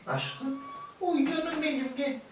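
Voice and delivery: high cooing pet talk; cooing to a cat. Acted. Calling the cat in, high cooing. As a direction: coo sweetly to call the cat.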